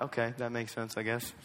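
low, very soft acceptance; half-hearted agreement. Acted. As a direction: go along quietly, unconvinced. Acting his half-hearted reaction in service.

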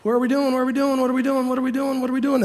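a touch of nervous panic; panicked whisper. Retold or imitated. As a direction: fret under your breath. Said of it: "these young guys are going".

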